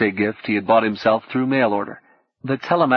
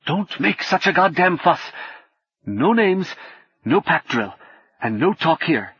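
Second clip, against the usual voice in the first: irritable scolding; 7 semitones higher than usual. A narrator's reading. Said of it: "waved violently to silence her".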